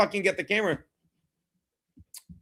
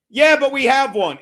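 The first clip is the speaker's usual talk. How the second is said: louder, higher imitated protest; insistent retort; 4 semitones higher, 8 dB louder than usual. Pizza deliverer at the door to the streamer, insistent. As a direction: protest loudly as the delivery person.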